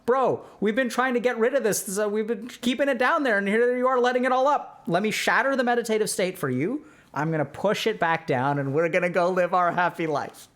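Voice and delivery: high voice of the mind protesting; high, pleading mind refusing to let go. Acted. The mind scolding the meditator, high and pleading. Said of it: "So the mind is like".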